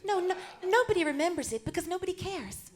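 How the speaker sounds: lightly voiced Hollywood starlet, lifted pitch; a hint of screen-siren impersonation; Marilyn Monroe. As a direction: play the starlet chatting to her audience.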